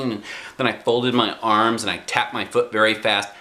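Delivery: plain reading voice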